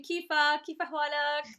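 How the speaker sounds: Lebanese Arabic greeting, loud and lively; Lebanese accent. Shown as a demonstration. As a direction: say it like a Lebanese local. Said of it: "in the Lebanese accent".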